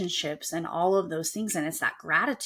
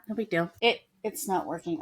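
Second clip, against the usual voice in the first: ordinary talk, nothing marked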